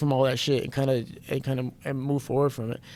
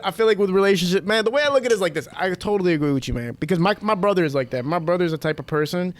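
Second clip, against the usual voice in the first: usual speaking voice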